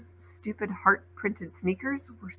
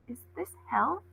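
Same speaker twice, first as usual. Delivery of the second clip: a touch of a small hushed voice, pitched up; frightened child asking under her breath; softly asking, a little scared; small-voiced whisper; 6 semitones higher, 8 dB quieter than usual. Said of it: "Coco whispered, small-voiced".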